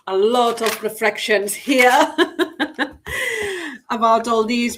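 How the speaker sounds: laughing through the words. Real, not acted. Guest laughs while sharing her reflections, laughing. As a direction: chuckle as you admit it.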